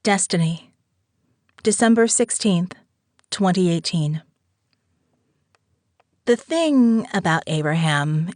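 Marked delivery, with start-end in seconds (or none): none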